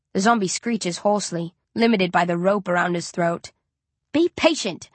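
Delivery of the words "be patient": snapping. Acted. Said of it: "I snap".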